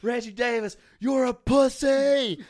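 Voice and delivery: high, slow heckling yell at the player; mock stadium heckle, drawn out and high; retold heckling shout. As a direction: bellow the insult across the stadium. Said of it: "Levi stands up and says".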